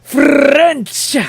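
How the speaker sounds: French said as fenʃ, high and loud with a falling end; funny French. Shown as a demonstration. Streamer shouting the word to chat for laughs, high and loud. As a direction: yelp French high and loud, nasal vowel, dropping at the end. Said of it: "It's just how to say it like that".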